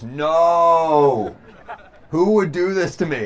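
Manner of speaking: loud, high, drawn-out mock wail; hammed-up despair. Friend finds his car vandalized, hammed-up anguished cry. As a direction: wail it like a melodramatic victim. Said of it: "falls to his knees and goes".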